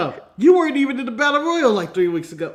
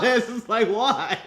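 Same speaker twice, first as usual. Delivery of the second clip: ordinary delivery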